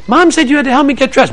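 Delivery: put-on high little-kid voice; little sibling. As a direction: whine it like a younger child. Little sibling demands help in acted example.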